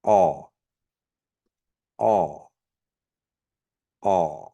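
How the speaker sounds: Aw with a popped k. Shown as a demonstration. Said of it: "The popped K".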